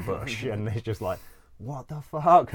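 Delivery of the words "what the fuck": high, loud startled "what the fuck"; retold shocked yell. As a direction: blurt it out as if a shot just went off. Gunshot hits the bush nearby.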